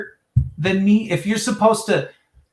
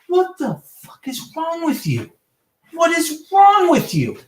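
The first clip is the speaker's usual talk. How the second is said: much higher, slow, drawn-out disbelief; incredulous; 9 semitones higher than usual. Real, not acted. Caller rants at the police dispatcher, slow high disbelief. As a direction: let the disbelief stretch each word.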